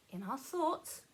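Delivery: ordinary talk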